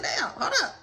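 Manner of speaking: muttering to herself, unsure whether to get out; hushed wondering inside the car; hushed inner thought. Retold or imitated. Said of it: "I'm just like".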